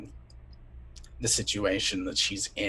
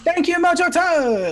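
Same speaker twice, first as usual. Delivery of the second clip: excited thanks; 10 dB louder than usual. Real, not acted. Thanking donor on stream.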